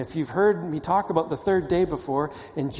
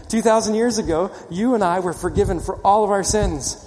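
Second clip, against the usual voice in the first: higher and louder, pressing the point home; louder emphatic repeat; 5 semitones higher, 5 dB louder than usual. Shown as a demonstration. Preacher repeating to the congregation, louder and fervent. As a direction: say it again with full force so they answer amen. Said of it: "I have to say it again".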